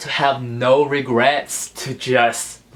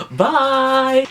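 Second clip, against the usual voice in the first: bright bye; 13 semitones higher, 5 dB louder than usual. Real, not acted. Cheerful sign-off.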